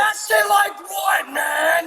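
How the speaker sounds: outraged shout. Real, not acted. Outraged reply.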